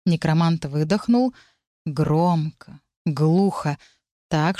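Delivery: ordinary delivery, nothing marked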